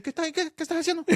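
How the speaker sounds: high, fast, mock-outraged question; retelling how she scolded her friend for using a fork; incredulous scolding. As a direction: act stunned at the fork. Scolding friend over fork use.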